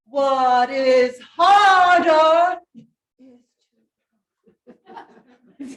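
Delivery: slow, loud, heavy on harder; mock heavy stress on "harder"; imitating the choir. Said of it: "what I hear is".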